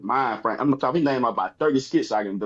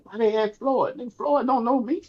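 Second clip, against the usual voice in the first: high-pitched puzzled protest; acted bewilderment in a high voice; baffled disbelief; 10 semitones higher than usual. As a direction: retell your disbelief, pitched well up. Retells his baffled reaction.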